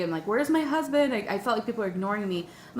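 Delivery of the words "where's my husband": panicked demand. Retold or imitated.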